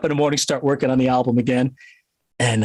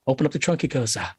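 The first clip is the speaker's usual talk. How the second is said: usual speaking voice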